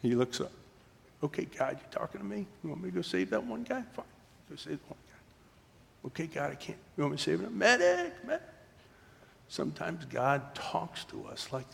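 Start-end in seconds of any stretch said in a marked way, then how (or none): 1.2-7.6 soft, higher-pitched questioning of God; hushed soldier seeking orders; acted prayer. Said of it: "he's praying to God". 7.6-8.5 very high distant call for help; acted high scream, cutting in; wounded man's cry. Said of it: "hear a guy who's wounded say".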